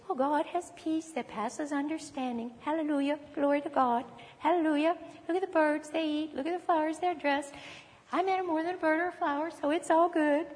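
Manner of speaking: mock the glib believer with a hint of silliness; silly voice. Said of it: "I'm saying it in a silly voice".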